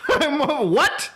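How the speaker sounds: breathy high laugh spilling into a disbelieving "what"; real laugh. Real, not acted. Streamer cracking up at YouTubers buying Lambos, laughing.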